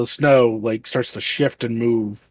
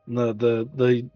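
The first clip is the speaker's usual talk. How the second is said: ordinary delivery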